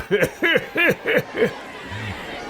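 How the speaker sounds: high, gleeful chuckle; villainous chuckle. Acted. Laughs after his villain lines.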